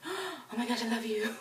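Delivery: high, gasping fangirl swoon; mock-swooning, high declaration of love; swooning fan. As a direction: imitate a friend falling at an idol's feet. Imitating friend dropping to knees, gasping.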